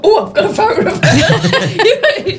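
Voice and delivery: a hint of a posh lady. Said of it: "And she talks like this".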